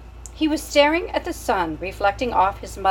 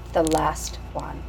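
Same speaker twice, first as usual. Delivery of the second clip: hushed whisper; 4 semitones lower, slower than usual. A narrator's reading. Narrator whispering the chapter title to the listener.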